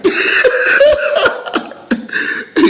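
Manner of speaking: a long, high, airy laugh. Real, not acted. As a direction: let a big high laugh roll out. Laughing at viewer comment.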